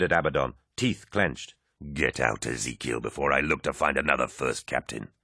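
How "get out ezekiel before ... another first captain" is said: low, threatening command; a cold final warning; menacing Horus. A narrator's reading. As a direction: Horus threatening his first captain.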